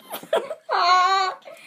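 real giggle fit; laughing. Real, not acted. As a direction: crack up laughing. Laughing while trying to sneeze.

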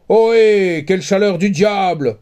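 loud, high hail; hearty call. A narrator's reading. Jean Balourd entering on his goat, calling out loud. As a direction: bluster in cheerfully. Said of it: "s'écria-t-il".

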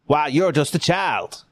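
Southern officer sizing up a captive; Frank Underwood accent. Acted. Confederate officer to a captured youngster, Southern accent. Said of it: "That's a Frank Underwood accent".

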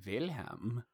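says Wilhelm with a V, German-style; a breathy German-sounding Wilhelm; German accent. Pretend. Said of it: "we should just most definitely be like".

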